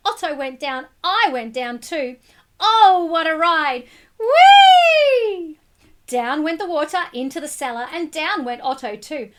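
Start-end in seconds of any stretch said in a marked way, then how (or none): 2.6-3.9 loud, thrilled cry; excited exclaim. A narrator's reading. Boy swept down stairs. 4.2-5.6 high, loud gleeful whee; joyful squeal. A narrator's reading. Boy riding the flood.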